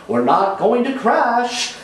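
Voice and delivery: high sing-song reassurance; sing-song flight attendant. Acted. Flight attendant soothing a panicking passenger, high and sing-song. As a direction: lilt it up like a bored senior stewardess. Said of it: "sing-song senior flight attendant".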